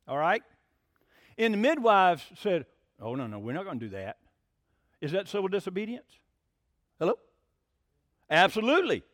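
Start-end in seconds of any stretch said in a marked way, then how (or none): 3.0-4.2 low, soft, quick quoted refusal; quiet refusal. Retold or imitated. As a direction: refuse quietly, under your breath. Acted midwives quietly refusing the order.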